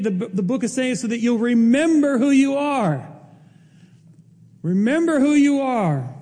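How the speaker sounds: impassioned sermon climax; preaching with force. Acted. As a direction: drive the point home to the congregation. Sermon building to emphasis.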